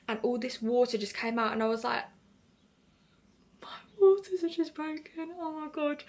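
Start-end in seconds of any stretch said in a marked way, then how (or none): 3.6-6.0 high, breath-filled half-whisper of shock; stunned gasp. Retold or imitated. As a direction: reenact the shocked realisation, pitched up high. Retold shock at waters breaking.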